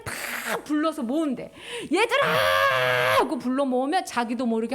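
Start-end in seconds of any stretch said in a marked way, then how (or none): 1.9-3.2 long, high, loud call. Acted. God calling all his scattered people, loud call. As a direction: call the children from far away.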